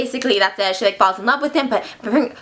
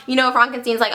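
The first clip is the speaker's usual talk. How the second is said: ordinary delivery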